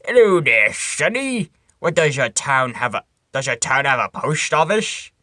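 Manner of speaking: put-on crotchety old man, cranky and loud; reading in a crotchety old man voice. Shown as a demonstration. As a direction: grumble like a cranky old-timer. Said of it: "your best crotchety old man voice".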